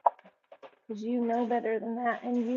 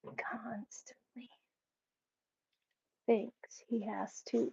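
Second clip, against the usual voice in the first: whispered aside; 8 dB quieter, slower than usual. Real, not acted. Whispered aside about dog.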